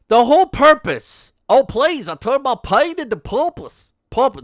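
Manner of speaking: sneering parody of a self-help speech; mocking pompous lady. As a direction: ham up the pain into purpose line. Mocking a speech, higher and louder.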